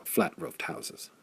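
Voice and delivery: plain reading voice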